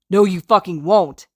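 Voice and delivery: loud furious shout. Acted. Kacchan shaking Izuku and shouting. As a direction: blast it in his face. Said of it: "Kacchan shouts".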